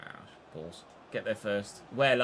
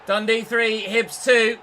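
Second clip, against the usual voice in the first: loud, high commentator-style cry; excited goal call; 9 semitones higher, 11 dB louder, slower than usual. Real, not acted. Player hails his side's goal with a loud, high shout.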